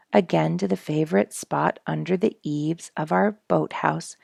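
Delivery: usual speaking voice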